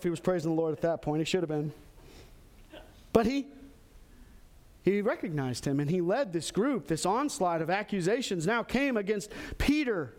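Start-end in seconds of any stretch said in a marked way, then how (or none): none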